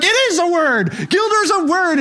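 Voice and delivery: high, slow, crowing voice; mock her victory in a high, drawn-out voice; British friend's triumphant retort. Pastor imitating his British friend winning at Boggle, high and crowing. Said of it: "And she's British".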